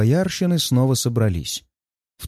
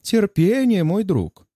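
smirking; 7 semitones higher, slower than usual. Acted. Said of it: "хмыкает Хлестаков".

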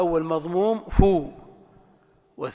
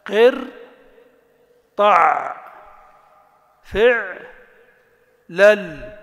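loud syllable drill; 2 semitones higher, 7 dB louder, much slower than usual. Shown as a demonstration. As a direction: bark each syllable out for drilling. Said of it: "إذا تمرنت على ذلك".

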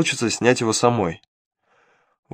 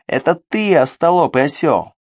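imp snaps back, higher and annoyed; irritated outburst; 5 semitones higher, 3 dB louder than usual. Acted. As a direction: blurt it out in irritation. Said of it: "раздражённо выпалил бес".

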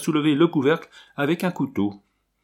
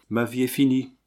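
plain reading voice

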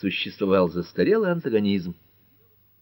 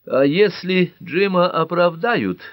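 slow, high, louder prim question; primly raised, deliberate challenge; 10 semitones higher, 5 dB louder, slower than usual. A narrator's reading. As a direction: stretch it out with starchy disapproval. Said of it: "чопорно заявила Герми".

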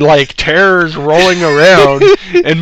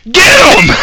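much higher, loud urgent call; mock urgent shout; 8 dB louder than usual. Staff shout to restrain the patient, high and urgent. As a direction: bark the order to grab the patient. Medical staff grabbing him.

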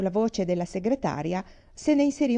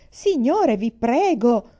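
high pleading shout, louder; yell; 5 semitones higher, 7 dB louder, much slower than usual. Acted. Director yelling at the stampeding crowd, desperate shout. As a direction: beg the crowd at full volume. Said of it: "urlò il direttore".

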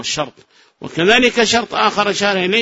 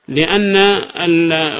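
normal talking voice

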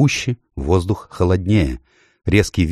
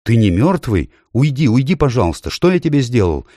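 put-on high, pleading scared boy; frightened boy; 6 semitones higher than usual. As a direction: beg it to go away in fright. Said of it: "Шаста вдруг страшно испугался".

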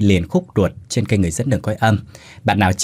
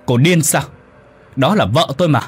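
snapping shout; 5 semitones higher than usual. Acted. Husband yelling at Bích after slapping her, angry shout. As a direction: bark it at her after the slap. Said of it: "mà quát".

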